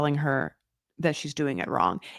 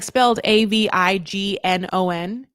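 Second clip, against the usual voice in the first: normal talking voice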